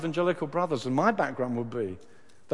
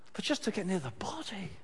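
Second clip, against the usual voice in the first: hushed, breathy whisper; 4 semitones higher, 7 dB quieter than usual. Acted. Preacher voicing Mary's longing, whispered.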